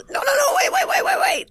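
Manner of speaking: very high, pleading wait, wait; panicked high voice begging her not to hang up; frantic pleading. Retold or imitated. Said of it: "He goes".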